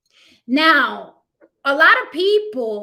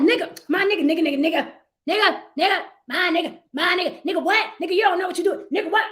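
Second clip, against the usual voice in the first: higher, hammed-up street rapper voice; mock R and B singer turned rapper; 5 semitones higher, faster than usual. Mocking R and B singers acting like rappers, higher street voice. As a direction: ape the tough rapper talk. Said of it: "now they're like".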